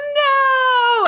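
high crying wail. Retold or imitated. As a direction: wail like a heartbroken kid. Child refusing doctor, crying.

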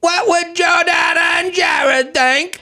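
very high, slow, shouted mock British accent; over-the-top British accent. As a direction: ham it up as an old-timey Londoner. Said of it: "speak like they're in the Mary Poppins era".

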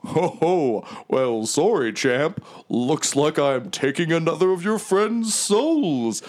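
theatrical swooping villain taunt; Mahadi. Acted. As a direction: relish the villainy with a ho-ho. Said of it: "Mahadi's like".

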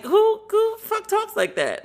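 retelling her own shocked reaction, voice jumping high; incredulous outburst. Retold or imitated. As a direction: pitch shoots way up in disbelief. Retold disbelief at host's slang.

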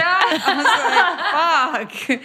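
laughing it out, high and slow. Real, not acted. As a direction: caught out and cracking up. Caught yawning in class, laughs.

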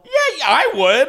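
high giggly yes; giggling. Real, not acted. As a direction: laugh through the answer. Giggling excited reply.

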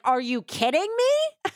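high-pitched retold disbelief; mock incredulous. Husband answering his wife's suggestion, in mock disbelief. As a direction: jump up high in imitated outrage.

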